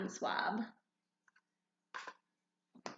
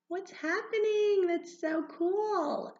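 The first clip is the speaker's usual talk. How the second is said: high, loud, slow wonder; kindergarten teacher voice; 10 semitones higher, 6 dB louder, slower than usual. Acted. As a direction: gush with wide-eyed amazement. Colors burst apart.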